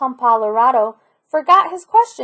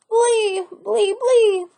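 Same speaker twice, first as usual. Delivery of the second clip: high bleating blee; goat bleat; 10 semitones higher, much slower than usual. A narrator's reading. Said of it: "Goat bleated".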